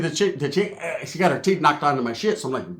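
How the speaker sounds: normal talking voice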